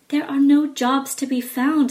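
a mother fretting over money; mother's voice. Acted. Mother tells Jack no work, worried. Mother answers, raised pitch.

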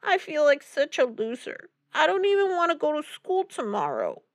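high-pitched, tearful self-pity; sobbing girl. A narrator's reading. Upset niece confiding in her uncle, tearful. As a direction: wail it mildly in a high girl's voice. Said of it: "she added with a sob".

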